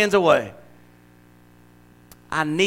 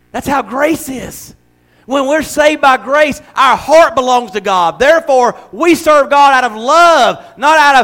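louder, slower, soaring preacher voice; preaching at full force; 5 semitones higher, 5 dB louder than usual. Acted. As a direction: build it like a revival climax.

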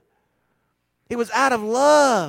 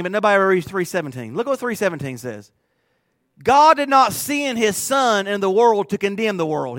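usual speaking voice

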